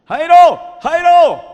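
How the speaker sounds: grito agudo y fuerte de llamada; llamando a gritos. Acted. Los muchachos llaman a Jairo. (los muchachos llaman a Jairo a gritos)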